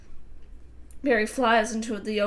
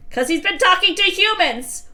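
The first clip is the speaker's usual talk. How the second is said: tattling; 9 semitones higher, 6 dB louder than usual. Acted. Family argument voiced.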